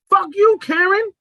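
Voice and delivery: slow, high, loud retort; acted defiant yell. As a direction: draw it out loud, playing the client. Acts out client's defiant retort.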